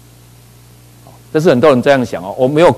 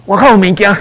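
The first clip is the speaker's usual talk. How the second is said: imitated shout, higher and louder; loud call; 8 semitones higher, 4 dB louder, faster than usual. Lepers announcing to the starving city, loud call. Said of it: "然后大声讲".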